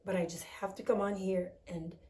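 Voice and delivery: soft, hoarse and groggy. Real, not acted. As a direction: let the voice fade groggily. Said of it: "the groggy voice".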